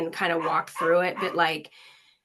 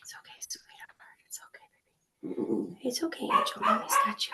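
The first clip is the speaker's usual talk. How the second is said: soft high whispered comfort; 10 semitones higher, 6 dB quieter than usual. Real, not acted. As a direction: hush and reassure tenderly. Whispered comfort during call.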